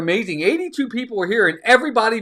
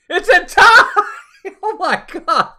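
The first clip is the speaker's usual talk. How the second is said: loud, high, laughing cry of surprise; surprised shout; 10 semitones higher, 7 dB louder than usual. Real, not acted. Streamer reacting to a tied poll, surprised laughing shout. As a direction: shout it out in disbelief.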